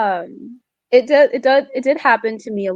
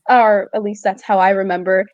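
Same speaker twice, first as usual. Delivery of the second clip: normal talking voice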